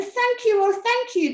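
high, loud gush of thanks; grateful cry. A narrator's reading. Witch thanking the animals who saved her, grateful cry. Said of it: "the grateful witch cried".

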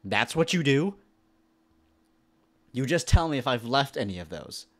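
indignant. Real, not acted. Annoyed reply to the game NPC.

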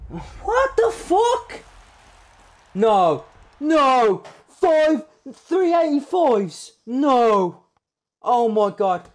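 very high, loud shocked cries; stunned disbelief. Real, not acted. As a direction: shout in total disbelief. Huge pack pull.

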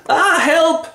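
high, hammed-up panic; mock cry for help. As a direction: shriek it in comic alarm. Acted cry for help, stabbing scene.

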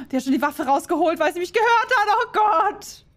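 high, loud panicked yelp. Real, not acted. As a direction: gasp it out in panic. Enemy drew weapon, panic.

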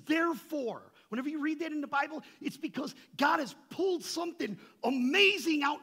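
loud, high, fast exhortation; fervent preaching. Real, not acted. Preacher urging the church about therefore, loud and high. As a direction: drive the point home with force. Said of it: "preacher stressing therefore".

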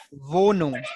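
"Wohnung" with a V start and long O; careful teacher's model of "Wohnung"; German W as V. Shown as a demonstration. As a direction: say Wohnung slowly as vo-noong. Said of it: "W sound in German is".

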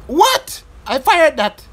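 shocked, loud and high; surprised outburst. Real, not acted. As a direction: blurt it out in disbelief. Shot fails to fire.

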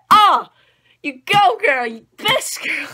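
high, loud fangirl cheer; loud, high cheer for Mikasa. Retold or imitated. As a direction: shout encouragement at the screen. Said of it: "I was like".

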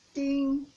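loud chiming ding; loud bell ding. Shown as a demonstration. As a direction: ring the bell hard. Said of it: "by saying ding".

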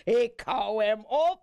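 high, breezy no; merry judge waving off the offer; jovial refusal. A narrator's reading. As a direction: refuse with playful firmness.

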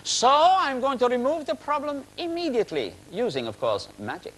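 lightly voiced grand showman; hammed-up magician. [name] introducing himself to the audience, mock grand. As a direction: announce yourself like a stage magician. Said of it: "it is I, [name]".

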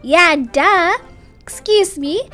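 plain reading voice

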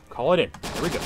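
ordinary delivery

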